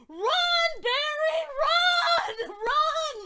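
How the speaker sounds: high, loud, stretched-out screams; frantic fan yelling at the screen; screaming at the TV. Retold or imitated. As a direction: urge the hero on at full volume. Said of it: "I'm screaming at the T V".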